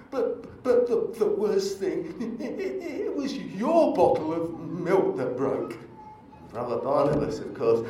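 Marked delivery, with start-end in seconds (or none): 0.1-5.7 high, hammed-up laughing sob; mock crying. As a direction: wail out the punchline in a fake cry. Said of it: "a terrible impression of somebody crying".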